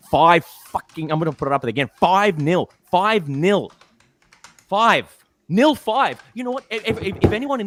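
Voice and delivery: high, loud gloating yell of the score; delirious football fan shouting the score; ecstatic shouting. Real, not acted. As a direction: crow the scoreline as loud as you can. Celebrating five nil.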